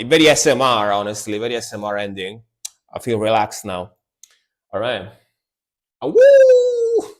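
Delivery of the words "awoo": high wolf howl; howling like a wolf pup. Retold or imitated. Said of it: "And the howling at the end".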